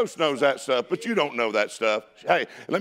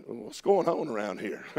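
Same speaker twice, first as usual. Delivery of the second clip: lower, softer acted question; puzzled, hushed aside; 5 semitones lower, 5 dB quieter than usual. Preacher as David finding everybody tucking tail, hushed puzzlement. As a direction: ask it low, under the breath. David arrives, finds army cowering.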